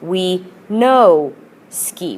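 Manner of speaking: drawn-out we no ski with each word given weight; slow, deliberate memory aid. Shown as a demonstration. Teacher slowly models a memory trick for students, drawn-out. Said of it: "think of it as no".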